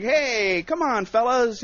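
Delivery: high, loud, drawn-out friendly appeal; nice-guy plea. Retold or imitated. Comic tries to win over a hostile bar, pleading amiably. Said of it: "I'm trying to nice guy it".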